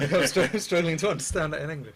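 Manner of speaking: giggling while teasing his co-host; laughing. Real, not acted. Joking about French commentary.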